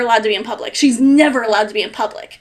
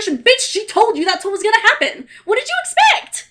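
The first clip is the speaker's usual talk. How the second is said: exasperated, high-pitched outburst; worked-up anger; 7 semitones higher than usual. Real, not acted. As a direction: fire back, incredulous and angry. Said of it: "I'm so worked up and angry".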